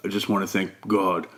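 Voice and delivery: low, soft, halting testimony; hushed low voice, pausing between phrases; man at the meeting. Retold or imitated. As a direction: imitate a quiet, deep-voiced meeting member.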